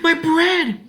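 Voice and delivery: high, loud panicked shriek. A narrator's reading. Ellen seeing her bread slip, panicked shriek. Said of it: "she shrieked".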